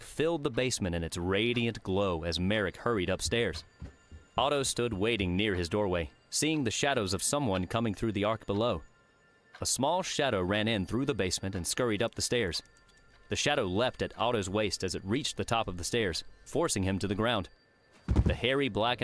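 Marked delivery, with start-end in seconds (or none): none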